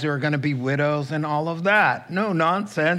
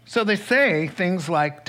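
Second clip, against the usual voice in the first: normal talking voice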